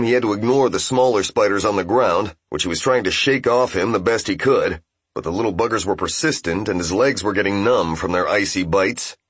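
usual speaking voice

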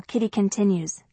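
ordinary delivery

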